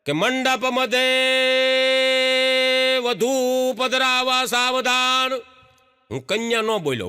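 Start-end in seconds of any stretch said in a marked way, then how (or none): 0.1-5.3 slow, drawn-out wedding priest chant; mock solemn priest's call. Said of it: "ગોરદાદા બૂમ પાડે".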